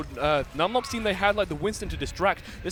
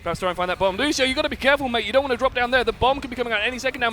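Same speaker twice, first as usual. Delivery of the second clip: ordinary talk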